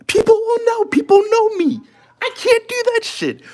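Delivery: very high, whiny protest; mock-distressed squeal. Real, not acted. Streamer protesting he can't fake the accent, squealed in mock distress. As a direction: squeal it in comic despair.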